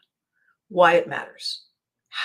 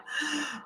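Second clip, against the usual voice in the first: a quick soft sharp intake of breath; demo gasp; 8 semitones higher, 10 dB quieter than usual. Shown as a demonstration. Explaining yawning to viewers, a soft demo gasp. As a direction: show the big gulp of air.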